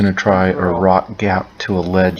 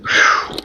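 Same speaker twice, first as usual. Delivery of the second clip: relieved sigh. Real, not acted. Relief after trying the drop.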